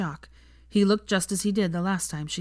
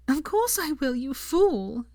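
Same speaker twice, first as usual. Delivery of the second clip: much higher, tearful happy yes; laughing joy; 9 semitones higher, slower than usual. Acted. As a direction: laugh through tears saying yes. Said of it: "she cried, laughing still".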